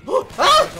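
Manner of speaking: short, high, loud yelp; startled scream. Real, not acted. Player attacked by zombies, startled scream. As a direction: yelp as a zombie jumps you.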